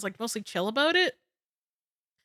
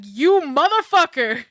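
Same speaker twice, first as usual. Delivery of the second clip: louder, slow playful yell at a friend; mock outraged shout; 5 semitones higher, 9 dB louder, slower than usual. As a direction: call out an old friend in joking outrage. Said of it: "I was like".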